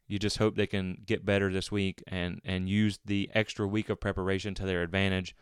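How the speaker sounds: ordinary talk, nothing marked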